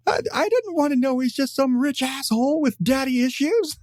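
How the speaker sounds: high, hammed-up indignant whine; Joker meltdown. Fan voices Joker appalled by Batman's identity, mock meltdown. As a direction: imitate an outraged villain in a high voice. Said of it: "has this total fucking meltdown".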